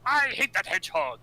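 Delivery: high, hammy cartoon villain line; villain catchphrase. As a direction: spit the catchphrase like a cartoon baddie. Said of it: "does the whole ... catchphrase".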